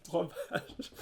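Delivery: laughing. Real, not acted. Echoes with a laugh.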